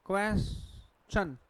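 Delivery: क्वेश्चन drawn out slowly, kwes-chan; slow careful pronunciation. Shown as a demonstration. Teacher models the correct word for students, slow and careful. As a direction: spell क्वेश्चन out slowly for the class. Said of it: "ये क्वेश्चन नहीं होता".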